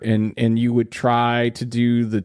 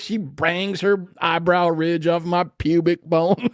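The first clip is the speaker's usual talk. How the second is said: higher Southern hillbilly voice; hillbilly accent; 7 semitones higher than usual. Pretend. Hillbilly persona joke.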